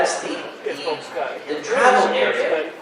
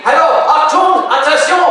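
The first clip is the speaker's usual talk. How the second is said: high, loud call for attention; calling across a noisy bar to a parent; a hammed-up warning yell; retold shout; 7 semitones higher, 3 dB louder than usual. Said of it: "I'm shouting".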